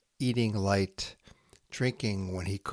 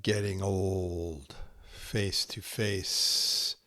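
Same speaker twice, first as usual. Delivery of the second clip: slow, hushed haiku recital with long pauses; poem reading; 3 semitones higher, slower than usual. Acted. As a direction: let each haiku settle into silence. Reads Santoka haiku aloud.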